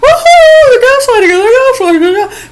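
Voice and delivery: hammed-up shrill pundit cry; mock Tucker. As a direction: shriek it like a frantic pundit. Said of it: "he just goes the other fucking way".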